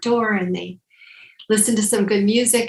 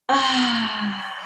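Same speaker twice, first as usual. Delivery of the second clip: audible exhale sigh. Acted. Exhale during shoulder roll.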